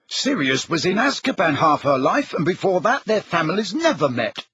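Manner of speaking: put-on high-pitched, insistent boy; Ron, disputing. Ron disputing that Tonks is grieving Sirius, insistent. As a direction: argue the point, pitch climbing.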